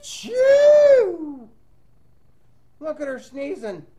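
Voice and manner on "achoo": huge loud high acted sneeze; big sneeze. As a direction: blast out a cartoon sneeze. Old lady sneezes in story.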